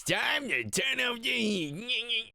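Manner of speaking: high, slow, hammed-up mockery; mock villain. Carl by the temperature gauge, mocked in a high voice. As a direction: parrot the line back as a silly villain. Imitating Carl's line from clip.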